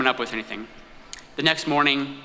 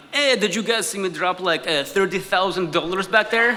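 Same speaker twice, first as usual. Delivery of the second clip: put-on higher-pitched Russian-accented English; Russian hustler asking in accented English; 4 semitones higher than usual. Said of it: "switch to English when they realize".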